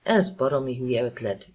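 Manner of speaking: ordinary delivery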